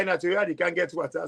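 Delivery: usual speaking voice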